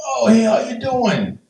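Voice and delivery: high, hammed-up presidential greeting; big, goofy greeting in a badly faked voice; terrible Trump impression. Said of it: "That's a terrible impression".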